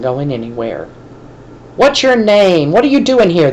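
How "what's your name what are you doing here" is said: higher, louder foreman's yell; hollering. A narrator's reading. Foreman catching a boy on his lot, hollering. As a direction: bark the questions at the trespassing kid. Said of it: "the foreman hollered".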